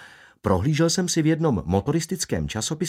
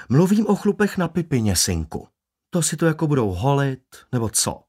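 higher-pitched, forthright barber; blunt barber; 4 semitones higher than usual. Acted. Barber explaining himself crudely to a customer, bluntly. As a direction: spell it out to a naive customer, pitch jumping up. Barber figure voiced beside narration.

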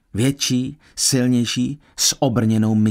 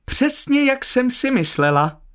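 very high, put-on exclamation; feigned excitement; 12 semitones higher than usual. A narrator's reading. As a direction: cry out in showy, staged excitement. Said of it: "vykřikla s hraným vzrušením". (s hraným vzrušením)

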